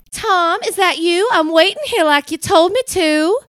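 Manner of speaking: high, loud call through a door; Penny. Acted.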